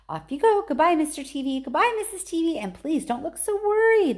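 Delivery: high, bright sing-song goodbye; cheery farewell. A narrator's reading. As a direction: wave them off merrily.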